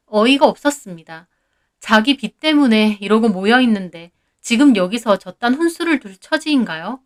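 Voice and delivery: plain reading voice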